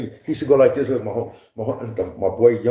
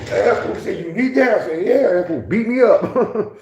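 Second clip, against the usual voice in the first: high, louder retelling through laughter; laughing; 8 semitones higher, 3 dB louder than usual. Real, not acted. Retelling the basketball loss, laughing. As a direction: keep laughing as you tell it.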